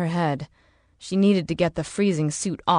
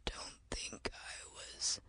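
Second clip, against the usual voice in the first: barely audible, slow whisper; dying whisper; 22 dB quieter, slower than usual. Acted. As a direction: whisper it slowly with failing breath. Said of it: "he whispered".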